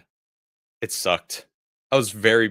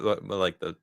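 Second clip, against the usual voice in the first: usual speaking voice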